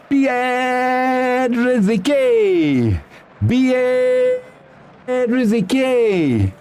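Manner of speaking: high, long-stretched cry of the scorer's name; drawn-out goal celebration. Real, not acted. Streamer hailing the goalscorer, ecstatic drawn-out cry. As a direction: hold each name like a goal commentator.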